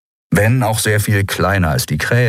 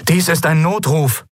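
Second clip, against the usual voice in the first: high, urgent radio distress voice; distress call; 9 semitones higher than usual. A narrator's reading. Stranger breaking in over the ship's bridge, urgent distress call. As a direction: send out a mayday in a raised, strange voice. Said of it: "rauschte unerwartet eine fremde Stimme".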